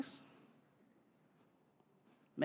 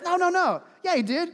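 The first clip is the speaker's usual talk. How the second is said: insistent denial; 10 semitones higher, 9 dB louder than usual. Retold or imitated. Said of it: "he goes".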